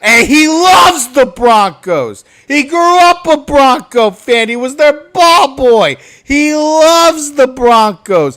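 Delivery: high, loud indignant ranting. Real, not acted. Fan ranting about the coach loving Broncos, loud. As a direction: hammer each point home.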